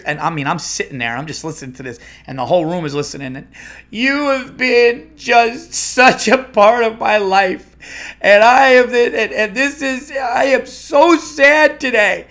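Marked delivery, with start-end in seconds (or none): 3.9-12.3 very high, loud, dragged-out mock lament; distraught caller. Devoted listener tells the retiring host her grief, mock distraught. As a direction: wail it slowly like an overwrought fan.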